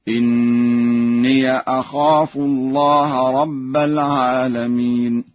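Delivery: "إني" as inniya, in slow chant; Warsh reading. Shown as a demonstration. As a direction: chant it slowly, opening the ya of "إني" with an a. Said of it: "يفتح ياء الإضافة". (تلاوة متأنية "إني" بفتح ياء الإضافة)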